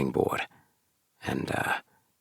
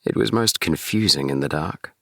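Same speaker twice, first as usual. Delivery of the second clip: ordinary delivery, nothing marked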